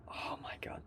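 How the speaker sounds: despairing whispered oh my god. Real, not acted. Too sleepy to work, a despairing whisper. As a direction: breathe it out in despair.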